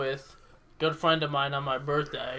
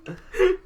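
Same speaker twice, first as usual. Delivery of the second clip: high laugh; 5 dB louder than usual. Real, not acted. Laughing at the kid.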